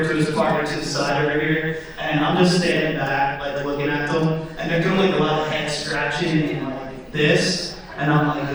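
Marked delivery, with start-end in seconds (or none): none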